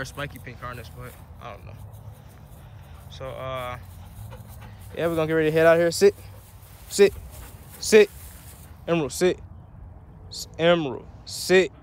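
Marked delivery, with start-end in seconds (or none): 5.9-11.7 firm command. Real, not acted. Owner commanding her puppy.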